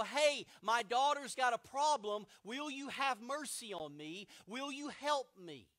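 hammed-up desperate plea; pleading woman. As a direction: play the nagging beggar at the window. Woman begging disciples for help.

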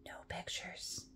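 whispered confiding aside. Acted. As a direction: share it like a secret.

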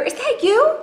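very high and loud; incredulous question. Acted. Square asking if it was Triangle, high and incredulous. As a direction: squeal the question in disbelief. Square discovers who tricked him.

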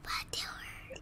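hushed aside; whispering. Real, not acted. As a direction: whisper behind her back. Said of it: "Why are you whispering?".

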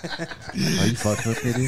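slow, laughing disbelief. Real, not acted. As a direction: laugh through the question. Laughing after the outburst.

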